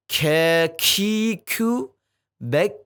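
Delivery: "Ke, ki, ku, bek" with a light k and air escaping after; airy front kaf chant; breathy kaf drill. Shown as a demonstration. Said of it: "kalau kaf ada hamesnya".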